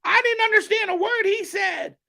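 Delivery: very high, strained screeching shout; over-the-top high-pitched yelling impression; Jim Cornette. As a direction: shriek it in exasperated caricature. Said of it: "Jim Cornette going".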